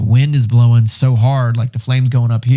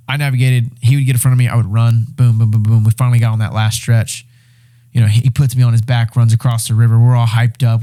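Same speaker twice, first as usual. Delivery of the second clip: ordinary talk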